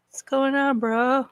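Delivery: a lightly voiced surfer dude. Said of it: "like, a surfer voice".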